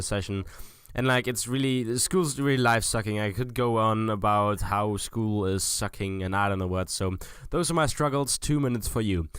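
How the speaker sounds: ordinary delivery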